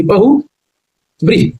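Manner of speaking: बहुव्रीहि slowly, in separated parts; syllable-by-syllable demo. Shown as a demonstration. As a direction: break it as बहु, then व्रीहि with a short final i. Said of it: "ह पर छोटी की मात्रा".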